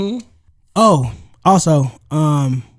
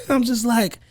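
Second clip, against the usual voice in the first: normal talking voice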